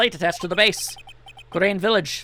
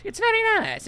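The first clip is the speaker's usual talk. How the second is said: very high mock squeak; squeaky high voice; 14 semitones higher than usual. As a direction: pitch way up like a cartoon. Mock high take on a line.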